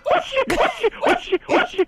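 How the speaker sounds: high squeaky oxi run. Acted. High mocking oxi burst. (oxi agudo)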